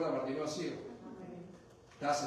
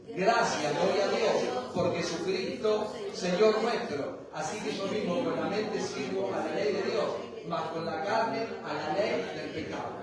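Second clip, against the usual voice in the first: group reading; 2 semitones higher, 5 dB louder than usual. Acted. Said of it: "Vamos a leerlo todos juntos".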